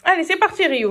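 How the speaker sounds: high cheerful dog call; talking to a dog. Real, not acted.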